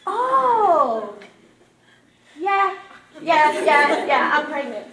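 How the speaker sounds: long, high, loud "oh" of remembering; comic loud realisation, stepping down in pitch; dawning realisation. Retold or imitated. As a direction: blurt it as it suddenly hits you. Sudden realisation she is pregnant.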